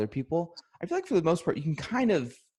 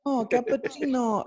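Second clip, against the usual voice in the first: hammed-up Italian, the penny finally drops; Italian sudden recognition; 12 semitones higher than usual. Italian barista finally getting the tourist's order, mock delight. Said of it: "you couldn't connect two and two?".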